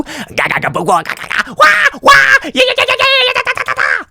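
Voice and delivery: very high shouted rapid-fire syllables; imitated angry music. Host imitates what pissed-off listeners play, high shouted vocal noise. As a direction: screech out aggressive music with your voice.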